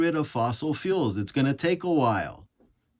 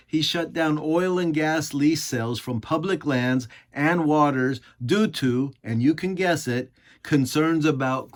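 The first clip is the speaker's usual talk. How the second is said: ordinary talk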